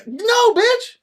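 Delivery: very high, loud, exasperated squawk; indignant correction. Real, not acted. Correcting the co-host's film title, high exasperated yelp. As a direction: push it way up in protest.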